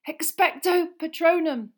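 high, loud strained spell; desperate incantation. A narrator's reading. Harry blinded by fog, desperately shouting. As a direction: force the spell out loudly.

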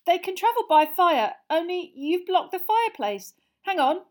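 high, loud, insistent; urgent boy explaining. Acted. Harry explaining the blocked fireplace to relatives, urgent.

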